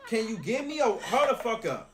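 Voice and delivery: normal talking voice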